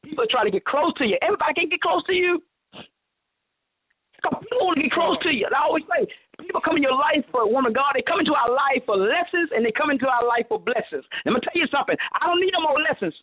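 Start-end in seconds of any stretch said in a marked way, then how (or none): 1.2-13.1 high, driving sermon delivery; full-force preaching. Real, not acted. Preacher delivering God's promise of blessing, fervent preaching.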